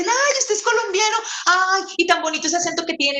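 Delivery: high, gushing impression of a charmed foreigner. A foreign friend fawning over a Colombian's accent, high and gushing. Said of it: "uno llega y dice".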